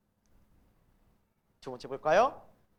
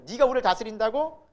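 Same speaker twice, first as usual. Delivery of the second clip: raised, incredulous challenge; acted indignant question; indignant brothers; 5 semitones higher, 6 dB louder than usual. Brothers challenge Joseph's claim to rule, acted indignation. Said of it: "막 열받는 거예요".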